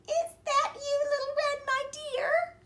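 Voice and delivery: very high quavering granny voice; old Granny calling. A narrator's reading. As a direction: call out like a frail granny. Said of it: "called Granny".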